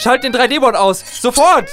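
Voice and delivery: high, desperate command; panicked shout. Acted. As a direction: scream the order in panic. Orders system to shut board off.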